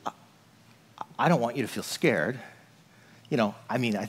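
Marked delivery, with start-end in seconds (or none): 0.1-2.4 much lower, much softer sorry; tender apology. Retold or imitated. Husband apologizing to his scared wife, tender and soft. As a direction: soften right down, reassuring her. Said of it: "then I'm like".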